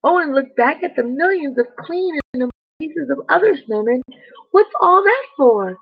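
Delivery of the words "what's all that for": higher, louder, puzzled; surprised question. Acted. Owen asks about the millions of snowmen, surprised. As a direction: ask it wide-eyed.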